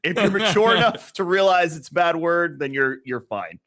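high, loud acted telling-off; mock exasperated parent. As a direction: raise your voice to settle an overexcited kid. Acted parent answering the excited child.

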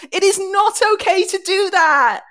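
loud, high, emphatic protest; indignant outburst. Real, not acted. Ranting about mocked accent.

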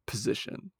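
hushed, suggestive imitation; soft mock-seductive whisper; flirty whisper. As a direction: whisper it as a come-on. Imitating a flirty line delivery.

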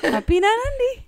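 high, loud pet-talk; baby talk to dog. Pretend. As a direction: coo it to the dog like a toddler. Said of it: "I say uppy".

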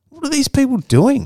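very high-pitched incredulous question; mock bewilderment. Seeing workers ignore the fight, high mock bewilderment. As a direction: squeak it out in exaggerated disbelief. Bewildered reaction to onlookers doing nothing.

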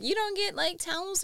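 incredulous, high. Retold or imitated. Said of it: "People are like".

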